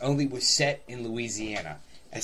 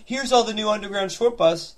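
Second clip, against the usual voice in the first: mocking imitation; 7 semitones higher than usual. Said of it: "You go".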